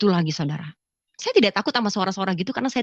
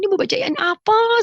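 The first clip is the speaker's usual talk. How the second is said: high-pitched puzzled question; retold baffled plea; 12 semitones higher, 4 dB louder than usual. Woman asking the voice what to read, baffled.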